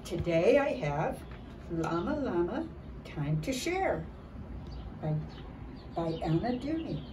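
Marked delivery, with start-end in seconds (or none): none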